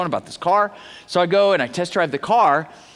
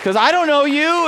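high, insistent declaration; retold emphatic exclamation; 7 semitones higher than usual. Baptizer answering the freaked-out gal, emphatic. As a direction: proclaim the answer, pitched well up.

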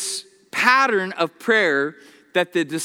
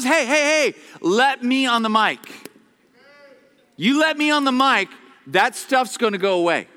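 voicing God; 6 semitones higher than usual. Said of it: "And God says".

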